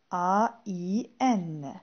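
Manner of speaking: "A I N" spelled letter by letter, very slow. Shown as a demonstration. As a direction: dictate A, I, N slowly to the class. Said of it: "un s'écrit avec A I N".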